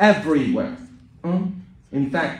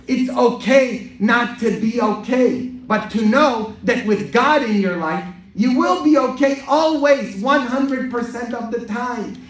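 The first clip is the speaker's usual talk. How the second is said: high, ringing sermon climax; full-force preaching; 11 semitones higher, 6 dB louder than usual. Acted. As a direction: lift the voice and assure the whole congregation.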